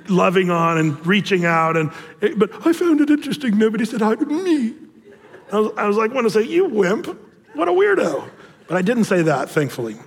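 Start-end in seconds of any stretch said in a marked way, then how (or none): 2.7-4.7 high, whiny mimicry of a sulker; mock the neighbour's self-pity in a raised pitch; exaggerated imitation of a wounded churchgoer; mocking complaint. 6.4-8.3 high-pitched playful name-calling; exaggerated, comic disdain; mock scorn. Imagined retort to the neighbour.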